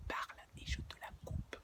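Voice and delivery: soft unvoiced menacing whisper; whispered threat to a tearful brother. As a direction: hiss the threat.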